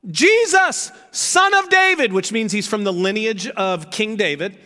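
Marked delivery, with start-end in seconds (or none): 0.1-2.1 loud, high desperate cry; shouting. Acted. Blind beggar shouting to Jesus, high and loud. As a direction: cry out to him from the roadside. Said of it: "he began to shout".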